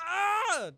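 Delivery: sharp high frustrated cry; frustrated yelp. Real, not acted. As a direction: yelp out in disgust. Frustrated outburst over Robin.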